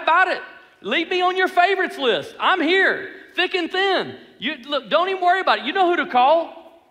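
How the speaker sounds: loud, high-pitched boasting; bragging. Acted. Peter promising Jesus he has his back, bragging. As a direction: pump up the boast, cocky and insistent. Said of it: "He's bragging to Jesus".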